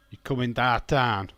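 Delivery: loud, broad Yorkshire with dahn-tahn vowels; Yorkshire accent. Shown as a demonstration. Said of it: "for missing out the T's".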